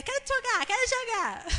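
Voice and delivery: put-on high, whiny child's plea; pleading child. Daughter begging to play, high and whiny. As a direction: beg like an eager kid. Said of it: "Aí a Ana".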